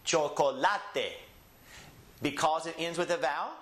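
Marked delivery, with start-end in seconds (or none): 0.0-1.2 slow Spanish chocolate, cho-ko-LA-te; Spanish next-to-last stress. Shown as a demonstration. Teacher shows it is Spanish, chocolate slowly with stress on LA. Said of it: "this is Spanish".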